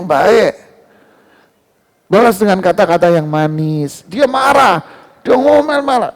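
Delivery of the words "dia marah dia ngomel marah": high, hammed-up imitation of a scolding spouse; mock nagging spouse. Preacher imitating a nagging spouse to the congregation, hammed-up. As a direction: lightly act out the angry nagging.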